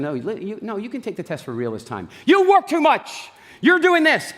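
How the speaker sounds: high, loud shrieking imitation of an upset wife; mock wife yelling. Husband imitating his upset wife's accusations, hammed-up high yell. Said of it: "She's gonna".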